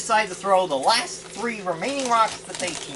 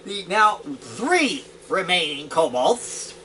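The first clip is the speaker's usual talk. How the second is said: ordinary delivery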